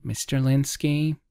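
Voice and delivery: lightly acted front-desk query; receptionist. A narrator's reading. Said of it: "the receptionist asked".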